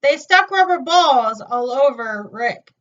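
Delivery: ordinary delivery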